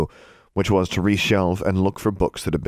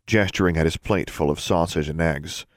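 plain reading voice

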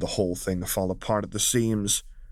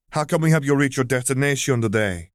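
higher, louder cheerful service voice; bright shop greeting; 6 semitones higher, 5 dB louder than usual. Acted. Young Togruta greeting customers at the counter, beaming. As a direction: welcome customers with a beaming smile. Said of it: "she greeted with a radiant smile".

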